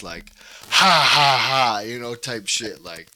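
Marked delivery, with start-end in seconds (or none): 0.7-1.8 loud fake ha ha; loud staged laugh in someone's face; mock laugh. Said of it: "laugh so hard in their face".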